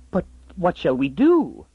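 gasping. Acted. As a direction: blurt it out in alarm. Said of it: "gasped the woman".

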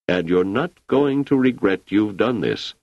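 usual speaking voice

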